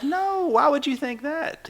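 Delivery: mock-innocent high reply; a hint of fake reassurance, slow; lightly voiced family. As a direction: parents brushing off the bear's question, mock innocent. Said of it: "And they're like".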